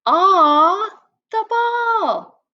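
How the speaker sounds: high, loud gleeful shout; excited. Acted. Teacher voicing an excited player as the ball comes, high shout. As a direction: cheer like the ball is the best thing ever. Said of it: "this guy is super excited".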